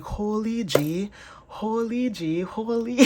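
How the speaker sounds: high, drawn-out Toronto impression; Toronto accent. Tarot reader mimicking a listener's astonished reaction, Toronto accent. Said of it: "like, Toronto".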